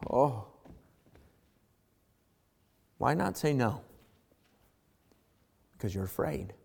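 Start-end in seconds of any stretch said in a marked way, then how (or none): none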